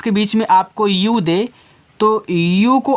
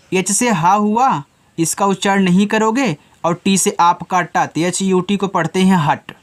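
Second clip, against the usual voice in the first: ordinary talk, nothing marked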